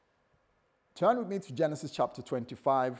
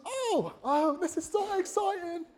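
high falsetto, overjoyed; gushing bride. Retold or imitated. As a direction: squeal with mock delight. Rebecca gushing.

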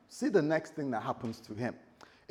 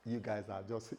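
ordinary talk

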